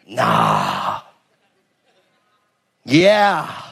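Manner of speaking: drawled dismissive "Nah", then a grudging laughing "Yeah"; skeptical nah. Pretend. A preacher voicing half-hearted belief: skeptical, then a grudging laugh. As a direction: brush it off, then give in with a laugh.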